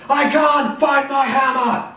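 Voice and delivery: slow, high, loud shout with a laugh; Thor shouting. Acted. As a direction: bellow it out in frustration. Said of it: "shouts Thor".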